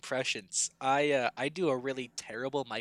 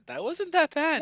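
high put-on quoting voice; imitated colleague; 12 semitones higher than usual. Host retelling control-room feedback, high mock voice. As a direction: pitch the reassurance up high.

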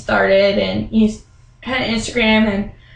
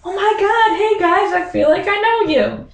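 gushing greeting; 10 semitones higher than usual. Retold or imitated. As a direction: greet them like long-lost friends. Meeting the couple she's heard about.

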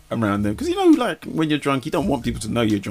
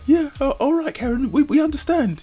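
high, humouring mock friends; high placating voice. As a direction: soothe a drunk friend, high. Friends humouring drunk Karen.

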